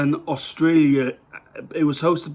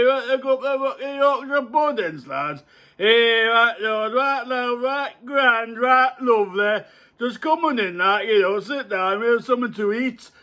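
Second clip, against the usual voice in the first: higher hammed-up Yorkshire accent; Yorkshire farmer; 7 semitones higher than usual. Inviting lads in to eat, hammed-up Yorkshire farmer. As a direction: play a blustery Yorkshire farmer welcoming the lads. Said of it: "I really should be in Emmerdale Farm".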